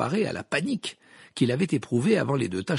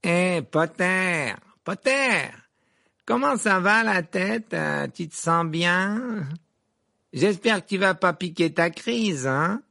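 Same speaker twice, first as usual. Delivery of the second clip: high, drawn-out jeering shout; taunting call; 8 semitones higher, 4 dB louder, slower than usual. A narrator's reading. Malfoy jeering at Harry across the great hall, calling out. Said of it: "s'écria à travers la grande salle".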